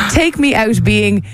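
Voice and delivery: ordinary talk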